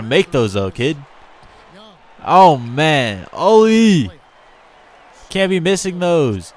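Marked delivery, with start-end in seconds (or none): 2.2-6.5 frustrated outburst. Real, not acted. Player misses easy shot.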